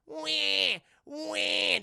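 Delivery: mock baby cry, high and loud; baby wailing. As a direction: imitate a baby's wail, high-pitched.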